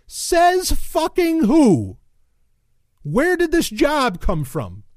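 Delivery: high-pitched, slow, drawn-out disbelief; incredulous, high and drawling demand; indignant outburst. Real, not acted. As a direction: stretch every word in outraged challenge. Indignant outburst at the text read.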